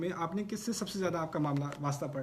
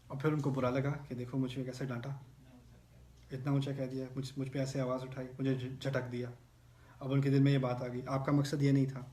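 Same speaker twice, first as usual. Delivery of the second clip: usual speaking voice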